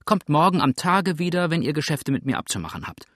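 high, loud dismissal that speeds up; brisk scolding landlady; indignant widow. Acted. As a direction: send the caller packing.

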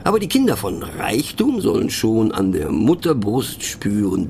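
normal talking voice

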